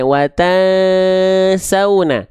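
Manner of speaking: long hum on "Wata" released into "sauna"; slow "Wata", humming, then "sauna"; concealed n into s. Shown as a demonstration. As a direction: hold the masked n, then move the tongue into the s of "sauna". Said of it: "ujung lidahnya mengarah ke gigi seri".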